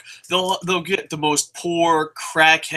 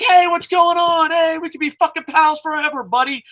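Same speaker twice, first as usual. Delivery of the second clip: mock friendly buddy; 12 semitones higher than usual. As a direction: greet him like a fake best pal.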